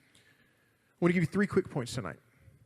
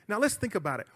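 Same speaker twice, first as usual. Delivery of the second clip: normal talking voice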